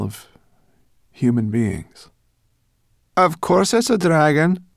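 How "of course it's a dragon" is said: high, loud, cross retort. Acted. Brownie snapping crossly at the boy. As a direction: snap back, annoyed by the obvious. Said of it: "said Sorrel crossly".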